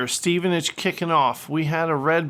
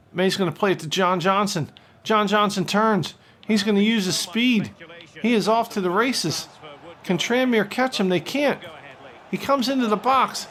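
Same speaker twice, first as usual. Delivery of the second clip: play-by-play commentary. Acted. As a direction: call the action like a TV commentator. Commentates his own FIFA match.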